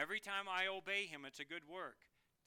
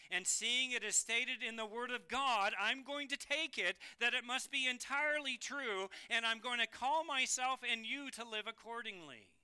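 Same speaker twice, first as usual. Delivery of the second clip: higher, insistent declaration; forceful preaching; 4 semitones higher, 4 dB louder than usual. Real, not acted. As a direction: declare it with full conviction. Preacher declares his conviction forcefully.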